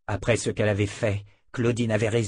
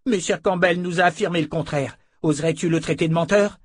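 higher-pitched indignant challenge; défi accusateur; 6 semitones higher, 3 dB louder than usual. A narrator's reading. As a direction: confront the liar with raised voice. Attacker angrily challenges the captive. (accusation indignée, voix montée)

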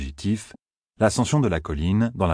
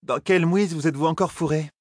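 higher-pitched woman, suddenly cutting in; female character voice; 9 semitones higher than usual. A narrator's reading. Woman surprising two fugitives from behind, high feminine voice. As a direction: toss out the reproach in a high feminine voice. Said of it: "lança soudain une voix féminine".

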